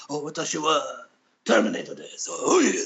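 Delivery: put-on deep, powerful Arnold in Japanese accent. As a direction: boom it out like a Japanese Terminator. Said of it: "a really funny, um, Japanese accent".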